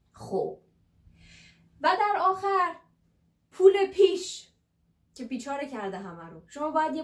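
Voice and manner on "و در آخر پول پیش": higher and louder, building to the punchline; emphatic. Real, not acted. Teacher joking about the dreaded deposit, loud and emphatic. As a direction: announce it with big emphasis. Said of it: "climax of list".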